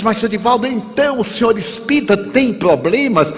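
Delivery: high incredulous question; a spiritist with problems?; amazed stranger. Retold or imitated. (estranho espantado, pergunta aguda)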